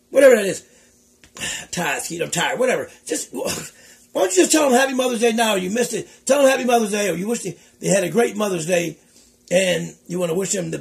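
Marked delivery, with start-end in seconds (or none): none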